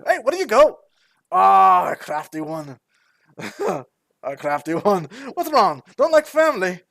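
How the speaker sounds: high, loud teasing dwarf voice; rowdy relative chiding a dodger; hearty dwarf. Acted. Dwarf whose hug was dodged, boisterously teasing.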